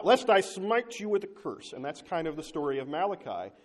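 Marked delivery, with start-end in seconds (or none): 0.0-1.7 1 stern divine threat. Acted. Preacher voicing God's threat.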